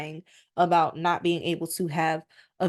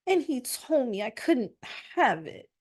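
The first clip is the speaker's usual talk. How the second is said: whiny Ahab; 4 semitones higher, 3 dB quieter than usual. Acted. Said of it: "that's how it comes off".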